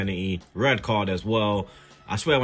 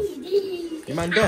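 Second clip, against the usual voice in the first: slow, high mocking voice; much slower than usual. Telling the noisy kids they're deaf, a high slow mocking voice. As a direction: tease them in a silly voice.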